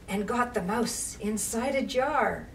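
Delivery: ordinary delivery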